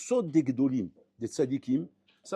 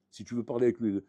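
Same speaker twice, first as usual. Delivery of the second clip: ordinary talk, nothing marked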